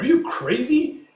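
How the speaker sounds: high, loud, disbelieving question; incredulous yell. Retold or imitated. Crewmate to Coin going out there, incredulous yell. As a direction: act out a shocked protest.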